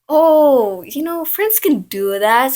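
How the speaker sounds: high, loud, swooping brush-off; imitated boy's excuse. Boy excuses kissing another girl, breezy mock voice. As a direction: mimic his excuse with exaggerated swoops.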